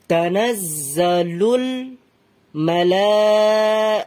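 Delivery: very slow Quran recitation, full voice; unhurried, sustained demonstration for learners. Shown as a demonstration. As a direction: stretch every long vowel and project. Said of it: "ধীরে ধীরে পড়বেন, but উঁচু গলায়".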